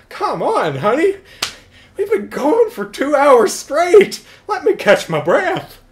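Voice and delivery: high, loud, winded plea; exhausted man pleading. Retold or imitated. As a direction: beg for a break, out of breath. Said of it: "It's like".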